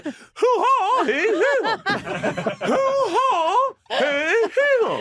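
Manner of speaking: very high wordless hostage impression; hammed-up gagged comedian yelps; Seinfeld. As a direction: squeal swooping syllables through imagined tape. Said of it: "duct tape all over".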